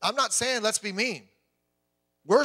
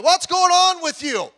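higher, louder, slow incredulous friends; mock loud question; 10 semitones higher, 11 dB louder, slower than usual. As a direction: call it out in disbelief. Some of them were like.

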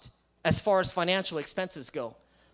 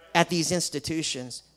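normal talking voice

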